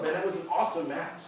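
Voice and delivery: normal talking voice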